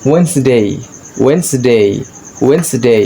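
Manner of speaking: ওয়েডনেসডে as "wens-dei", silent first D; correct pronunciation. Shown as a demonstration. Teacher modelling for learners, correct pronunciation. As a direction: model ওয়েডনেসডে in two syllables. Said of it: "এটা সঠিক উচ্চারণ হচ্ছে".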